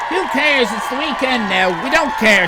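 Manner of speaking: loud, high cheer that the weekend is here; Friday shout; gleeful shout. Real, not acted. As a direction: shout it out to the whole chat.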